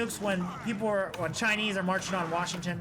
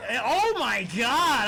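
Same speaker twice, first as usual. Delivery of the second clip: slow, louder drawn-out oh my God; startled gasp; 3 semitones higher, 5 dB louder, much slower than usual. Real, not acted. Streamer spotting a creepy spider mid-sentence, startled and slowed. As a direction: caught off guard by something creepy.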